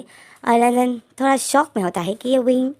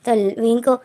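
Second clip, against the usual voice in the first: plain reading voice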